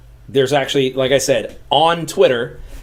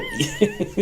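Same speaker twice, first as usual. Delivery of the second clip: high laugh; 5 dB quieter than usual. Real, not acted. Laughing at the impression.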